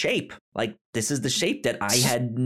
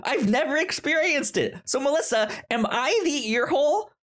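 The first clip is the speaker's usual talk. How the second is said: mock outrage; 13 semitones higher, faster than usual. Real, not acted. Complaining about earbuds not fitting.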